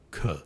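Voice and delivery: "k" alone, quick release; isolated k. Shown as a demonstration. Teacher demonstrates the bare k sound. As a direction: say only "k". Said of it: "Just say".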